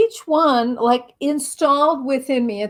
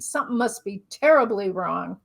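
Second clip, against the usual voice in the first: usual speaking voice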